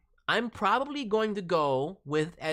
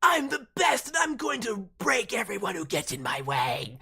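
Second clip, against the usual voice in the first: higher, shouted bratty boast; Wildfire's gremlin energy; 6 semitones higher than usual. Retold or imitated. Streamer mimicking the character Wildfire's attitude, shouted bratty boast. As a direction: belt it out like a cocky little gremlin. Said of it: "Her energy of just like".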